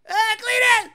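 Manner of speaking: high, loud fan's call. Pretend. Fan at Cletus's pit calling him, loud high call. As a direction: shout his name across the pit. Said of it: "you're like".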